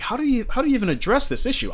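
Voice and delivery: high-pitched amazed question; baffled disbelief. Retold or imitated. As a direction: ask it in disbelief. Retold own baffled question.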